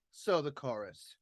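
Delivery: usual speaking voice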